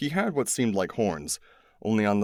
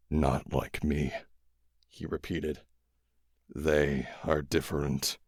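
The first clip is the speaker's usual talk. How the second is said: low, very slow weary voice; dying alien; 5 semitones lower, 3 dB quieter than usual. A narrator's reading. Dying alien correcting the boys, low and slow. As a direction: drag it out, grave and tired.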